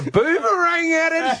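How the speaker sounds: high, very slow hammed-up Australian accent, laughing; mock Aussie accent. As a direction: play a cartoon Australian. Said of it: "You want some more Aussie accents?".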